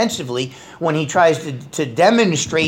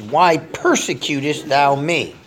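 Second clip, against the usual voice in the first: slow solemn question; slower than usual. Acted. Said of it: "he says".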